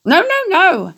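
very high, sing-song goose call; honking goose cry. A narrator's reading. Goose urging Wilbur to dodge, high honking cry. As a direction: squawk the orders like a flustered goose. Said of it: "said the goose".

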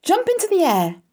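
loud, very high command; crying out. A narrator's reading. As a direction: cry it out like a coach mid-drill. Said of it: "cried Charlotte".

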